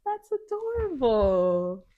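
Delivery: loud, high, stretched-out cooing; adoring gush. Real, not acted. Friend hearing about nephews' hero poses, adoring gush. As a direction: draw it out high and loud, charmed.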